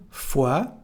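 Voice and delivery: "fois" ending on the "wa" vowel; "fois" said as "fwa"; silent final S. Shown as a demonstration. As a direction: leave off the S of "fois". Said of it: "same thing here".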